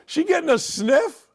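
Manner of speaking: usual speaking voice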